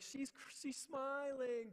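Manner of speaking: high, loud, slowed voice about to cry; overwhelmed new dad. Retold or imitated. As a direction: squeeze the words out in joyful disbelief. Overwhelmed new father telling wife.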